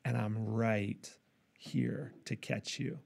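God whispering close into a listener's ear; soft whisper. Retold or imitated. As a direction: breathe it into their ear like God. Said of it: "whispering in our ear".

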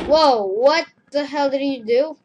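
loud, high startled outburst; shocked shout. Real, not acted. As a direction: react in loud disbelief. Shocked at what the killer did.

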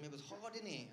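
ordinary talk, nothing marked

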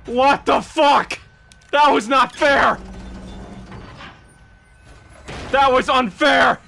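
complaining it was rigged; indignant. Real, not acted. Streamer protests an unfair death to chat, indignant.

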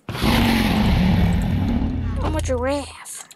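goofy drawn-out voice. Pretend. Gamer goofing around, stretching out I'm a giraffe. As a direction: drag every word out ridiculously.

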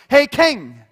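loud, high, overfamiliar hail; brash American greeting. Retold or imitated. Babe Ruth greeting the king, loud and brash. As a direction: holler it like the king's an old pal. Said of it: "he just went".

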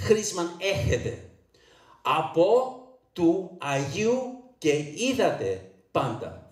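"Chrisman έχετε. Από του Αγίου και είδατε πάντα" in slow Cypriot Greek; original Cypriot pronunciation. Shown as a demonstration. Preacher teaching congregation, slow Cypriot Greek "Από του Αγίου και είδατε πάντα". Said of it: "the way it should be read".

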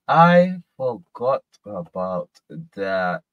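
"I forgot about that" slowly, word by word; slow, emphatic. Real, not acted.

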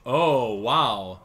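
ordinary talk

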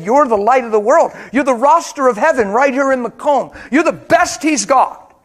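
loud, high, ringing preacher's appeal; preaching at full force. Acted. Pastor urging the congregation in Macomb, loud and high. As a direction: lift the church up with it.

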